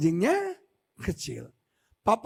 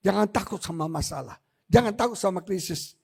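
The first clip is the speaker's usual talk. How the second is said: ordinary talk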